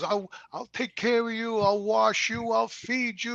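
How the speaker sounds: high-pitched, anguished promises, retold; imitated wailing plea, not full sobbing; imitated tearful pleading. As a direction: echo a father's desperate cries, pitched well up. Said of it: "my father was crying hysterical".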